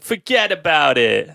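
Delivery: slow, hammed-up New York "forget about it"; New York accent. Cop voice retried on request: slow hammed-up New York accent. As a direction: lean into the mobster drawl. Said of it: "should I try it again".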